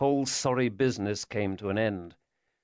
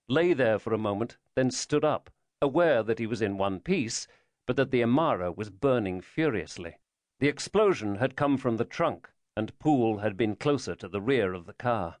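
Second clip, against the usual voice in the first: ordinary delivery, nothing marked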